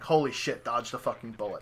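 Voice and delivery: whisper-quiet relief, half to himself; relieved under-breath curse. Retold or imitated. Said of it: "And I'm like".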